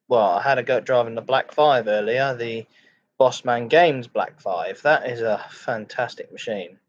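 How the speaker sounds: ordinary talk, nothing marked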